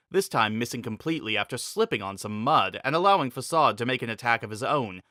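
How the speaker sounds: usual speaking voice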